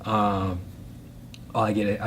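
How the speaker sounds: usual speaking voice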